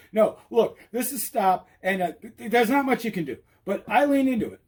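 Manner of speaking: usual speaking voice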